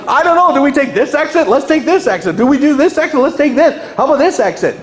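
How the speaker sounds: high, loud, panicky driver; a driver who just lost the GPS, panicking; hammed-up lost-driver panic; mock panicked driver. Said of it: "And you're like".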